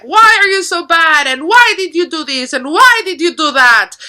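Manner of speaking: high, loud mock scolding; imitating angry screaming mom. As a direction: play a mother yelling at her kid. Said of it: "our mom is angry at us and she's screaming".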